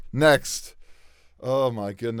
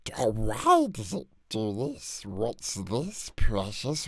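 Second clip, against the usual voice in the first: Gollum slip; 4 semitones lower, 4 dB quieter than usual. Acted. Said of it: "Why do I keep slipping into Gollum?".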